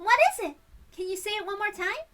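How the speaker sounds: high-pitched, eager prompt; eager questioning. Acted. Friend asking a shy girl to repeat herself, high and eager. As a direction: pitch it well up as a coaxing question.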